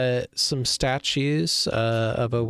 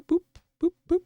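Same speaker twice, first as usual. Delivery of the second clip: beep boop sound effect; much faster than usual. Pretend. Teleporting player upstairs.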